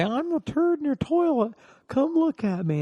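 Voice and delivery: squeaky high put-on voice, played for laughs; prank caller voice. Prank calling a stranger, silly high squeaky put-on voice. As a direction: pitch it way up like a goofy prank caller.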